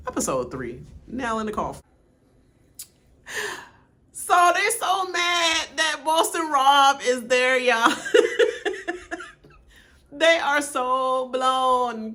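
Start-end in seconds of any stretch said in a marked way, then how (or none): 4.1-12.1 loud, higher and slow, gushing with laughter; excited shout. Real, not acted. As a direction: burst out with glee. Gossiping excitedly about the show.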